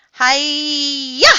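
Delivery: high, loud, stretched "hi-ya"; ninja battle cry. Acted. Froggy fighting a coconut tree, ninja yell. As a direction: wind up and burst on "ya".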